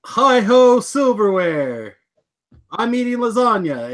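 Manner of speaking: high, drawn-out mock battle cry; playful yell. Host pitching a silly show intro to co-host, playful yell. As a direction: call it out high, stretching the words. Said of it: "And then you could yell".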